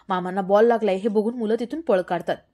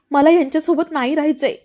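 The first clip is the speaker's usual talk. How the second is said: tearful outburst; 5 semitones higher, 9 dB louder than usual. Acted. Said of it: "अजून रडते".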